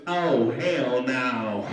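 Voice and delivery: low, loud, very slow refusal; heckler. Acted. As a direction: bellow it low and drawn out. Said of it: "one guy in the back goes".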